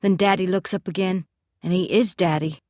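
ordinary delivery

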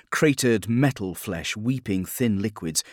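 usual speaking voice

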